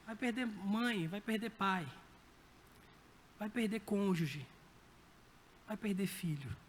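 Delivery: ordinary talk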